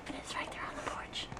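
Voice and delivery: hushed whisper. Real, not acted. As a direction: whisper so it doesn't hear. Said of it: "it's still out there".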